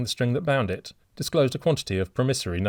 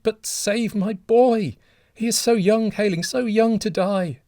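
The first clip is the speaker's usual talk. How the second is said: high, loud, desperate pleading; anguished begging; 13 semitones higher, 6 dB louder than usual. A narrator's reading. Kneeling father begging the stranger to take his life instead, anguished. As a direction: offer your own life in anguish.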